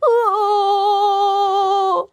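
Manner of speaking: high, long-held frightened howl; startled tiger cry. Acted. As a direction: yelp in alarm and hold it. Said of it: "라오후는 깜짝 놀라". (깜짝 놀란 호랑이의 비명)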